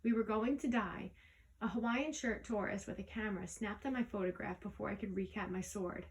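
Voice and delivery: ordinary delivery, nothing marked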